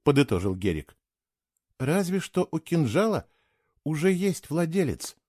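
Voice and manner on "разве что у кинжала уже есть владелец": usual speaking voice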